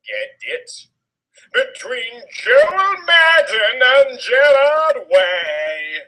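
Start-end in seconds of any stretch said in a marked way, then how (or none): 1.5-6.0 hammed-up punchline. Overacted punchline comparison.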